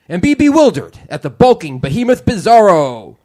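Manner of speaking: booming step-right-up showman; circus ringmaster. Acted. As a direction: sell the show like a carnival barker. Reads circus ad copy.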